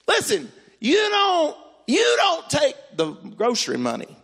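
high, slow, emphatic mock scolding; indignant scolding. Telling off God about the grocery money, indignant.

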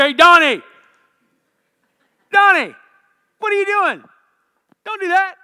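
very high, loud scolding yell; God's shouted rebuke. Acted. God calling Donnie out, loud angry shout. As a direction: shout him down in sharp bursts. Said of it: "doesn't talk to me like that".

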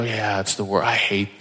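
lightly voiced whining churchgoer. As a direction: parody a lazy believer griping. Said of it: "we have the nerve to go".

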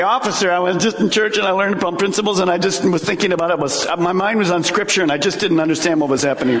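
fast, rambling mock excuse to the officer; hurried pleading excuse to a traffic cop; begging excuse. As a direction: babble the excuse to get out of a ticket. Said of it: "you wanna say".

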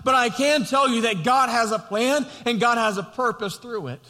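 full-force pulpit preaching; impassioned preaching. Acted. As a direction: preach it out to the congregation.

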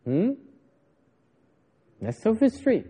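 normal talking voice

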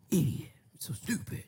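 harsh whispered self-rebuke; whispering angrily at his own failure; self-scolding whisper. Acted. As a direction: hiss it at yourself in disgust. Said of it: "mad at himself".

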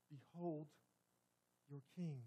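soft, hushed proclamation; solemn presentation. Acted. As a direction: hush it, a grave unveiling. Repeated solemn refrain.

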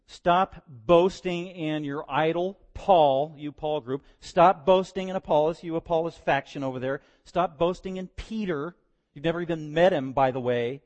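loud, slow preacher rebuke; preaching. Acted. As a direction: call out each faction from the pulpit.

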